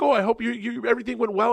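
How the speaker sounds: high-pitched, polite little voice; hammed-up courteous aide; assistant impression.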